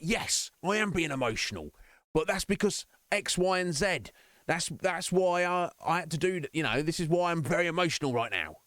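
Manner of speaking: high, insistent self-justifying mock outburst; mock emotional outburst. Streamer acts out defending his emotional outburst, mock worked-up. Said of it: "even if I have an emotional outburst".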